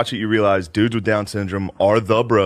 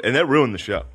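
normal talking voice

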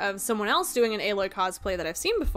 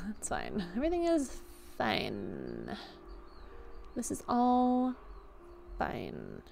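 mock forced calm; 8 dB quieter, slower than usual. Streamer pretending the exhausting week is okay, slow hushed denial. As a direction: drag it out as if barely coping. Joking that all is fine.

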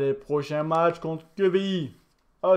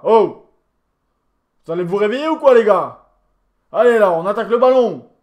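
louder, higher, urgent scolding; yelling at the team; 4 semitones higher, 9 dB louder, faster than usual. Real, not acted. Gamer yelling at his sluggish virtual players, loud scolding. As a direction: wake them up with a shout.

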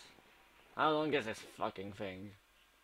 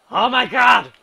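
much higher, much louder yell; loud shriek; 11 semitones higher, 19 dB louder than usual. Real, not acted. Gamer screaming at a sudden crash, loud shriek. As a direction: scream it in pure shock.

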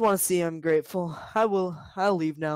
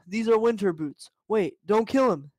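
ordinary talk

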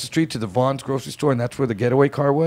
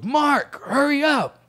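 much higher, pressing call to the back; urgent hurry-up; much slower than usual. Retold or imitated. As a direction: hurry your partner, tense. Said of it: "And he goes".

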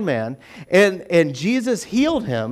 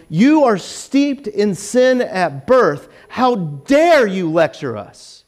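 slow reading of the rebuke; slower than usual. Retold or imitated. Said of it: "they replied to him".

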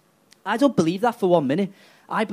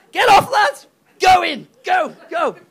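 much higher, loud barking yells; shouting orders; 12 semitones higher, 8 dB louder than usual. Acted. Londoners herding Scousers into the waves, yelling high. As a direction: drive them into the sea.

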